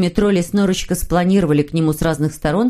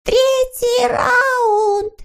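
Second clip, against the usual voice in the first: very high, stretched-out referee's cry; drawn-out yell; much slower than usual. A narrator's reading. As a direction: announce the round in a long, high wail. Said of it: "завопил Сниф". (протяжный высокий вопль судьи)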